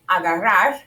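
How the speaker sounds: agarrar with a slight breathy puff at the end; aspirated final R. Shown as a demonstration. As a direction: let a little breath out after agarrar. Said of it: "can you hear the little breath".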